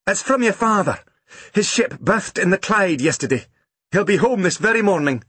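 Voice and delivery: 音调高起来的母亲，满心欢喜; 像报喜一样把消息说出来; 高兴的母亲. A narrator's reading. Mother tells happy news.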